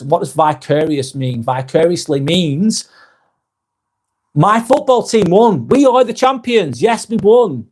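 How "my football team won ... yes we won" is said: mock gloating fan. Mocking a gloating football fan.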